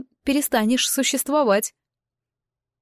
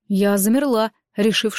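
usual speaking voice

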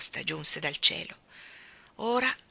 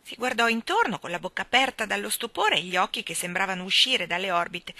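plain reading voice

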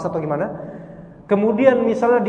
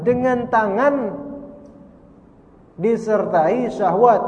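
DENGAN TANGAN, DISERTAI SYAHWAT slow and high, split by a pause; emphatic repetition; 5 semitones higher, slower than usual. Shown as a demonstration. Teacher drilling the rule, "dengan tangan ... disertai syahwat" slow and weighty. Repeats key condition after "remember.

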